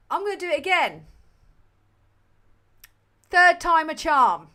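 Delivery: loud and fed up, pushing through; frustrated loud. Real, not acted. Streamer restarting the intro after mic failure, exasperated and loud.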